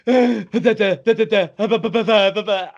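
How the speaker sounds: high, loud speechless babbling; mock fanboy unable to form words; starstruck stammer. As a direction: gasp and stutter syllables, overwhelmed. Imitates friend stammering at idol.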